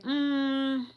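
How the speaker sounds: normal talking voice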